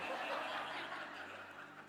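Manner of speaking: soft huffed laugh with no voice; a short airless chuckle. Real, not acted. The preacher laughs at the polite time signal, soft and huffed.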